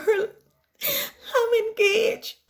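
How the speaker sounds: high, slow, laughing through tears; tearful squeal. Acted. Bride-to-be tells her friend she's engaged, laughing and tearful. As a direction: let the joy break into tears.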